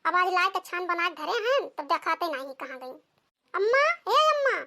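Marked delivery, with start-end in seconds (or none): 3.5-4.6 loud, high calls for mother across the house; calling out. Acted. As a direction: call out to her mother, worried and whiny. Daughter calling mother again. (बेटी का अम्मा को ज़ोर से पुकारना)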